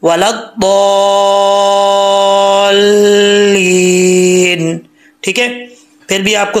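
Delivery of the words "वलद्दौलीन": वलद्दौलीन chanted very slowly, vowels drawn far out; slow Quranic elongation; long stretched recitation. Shown as a demonstration. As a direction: hold the दौ long and glide into लीन. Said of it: "दौद को लंबा करके लाम के साथ connect करें".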